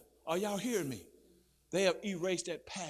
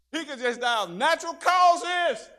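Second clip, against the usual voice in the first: emphatic preaching; 4 semitones higher, 12 dB louder than usual. Real, not acted. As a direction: hammer the point with force.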